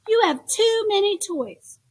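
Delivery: high, loud scolding; a fed-up mother yelling at her son. Acted. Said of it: "she yelled".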